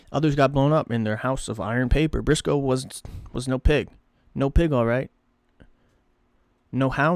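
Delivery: ordinary delivery, nothing marked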